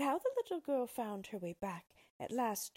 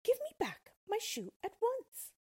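put-on high, louder indignant girl; indignant child's high voice; cross demand; 5 dB louder than usual. A narrator's reading. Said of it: "said Dorothy crossly".

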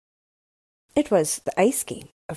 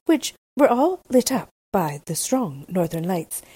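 ordinary delivery

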